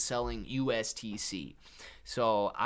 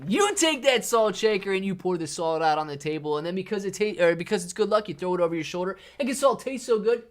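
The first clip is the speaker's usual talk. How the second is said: higher, louder, rushing at the end; excited riff; 5 semitones higher, 5 dB louder than usual. Real, not acted. Streamer excitedly riffing to chat about salt. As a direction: get carried away with it.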